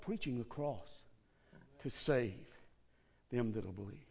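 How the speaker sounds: normal talking voice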